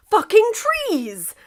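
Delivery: high, loud, slow outburst; furious curse. A narrator's reading. Narrator voicing England after crashing into a tree, furious. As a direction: spit it out in rage.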